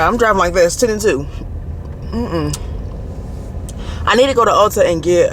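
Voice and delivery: ordinary delivery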